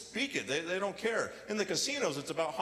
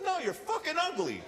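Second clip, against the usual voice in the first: put-on cashier girl's blunt reply; 10 semitones higher than usual. Cashier's punchline in a story.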